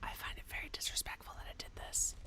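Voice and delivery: soft disbelieving whisper. Real, not acted. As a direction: whisper it like a confession. Whispered aside.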